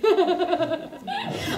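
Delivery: a bright cackle; cheeky giggle. Real, not acted. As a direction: laugh at your own cheek. Laughs after beard remark.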